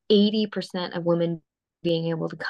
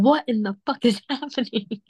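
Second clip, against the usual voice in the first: mock shocked woman; 2 semitones higher than usual. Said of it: "normal woman would be like".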